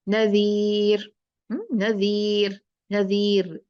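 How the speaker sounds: नज़ीर slow, light final र; thin ra. Shown as a demonstration. Teacher demonstrates light ra in नज़ीर. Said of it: "मुरक्कक पढ़ना है".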